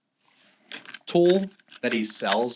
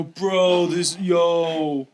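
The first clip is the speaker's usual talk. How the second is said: imitating rattled ghost hunters; hammed-up nervous bro; mock freak-out; 2 semitones higher than usual. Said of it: "they all freak out".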